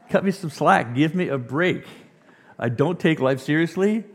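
loud, high, incredulous outburst; hammed-up outrage, pitched high; mock indignant protest. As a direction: protest the unfair verdict at full voice. Protests his first-grade report card.

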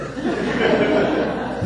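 a short breathy chuckle with no voice; amused chuckle. Acted. Jesus's amused reaction.

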